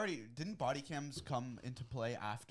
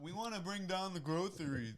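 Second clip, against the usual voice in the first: mocking politician; 6 semitones higher than usual. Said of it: "What do they say every time?".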